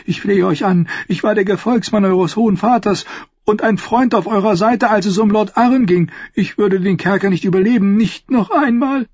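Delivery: put-on old maester pleading, voice lifting at the end. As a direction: beg for your life on your knees. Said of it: "Pucelle fiel auf die Knie".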